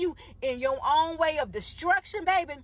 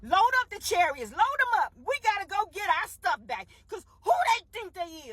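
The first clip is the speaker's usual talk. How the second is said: Pharaoh's higher, louder orders; angry command; 7 semitones higher, 3 dB louder than usual. Retold or imitated. Pharaoh ordering his army after Israel, angry. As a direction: bark the order to load up. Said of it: "He said".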